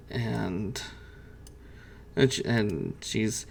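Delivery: normal talking voice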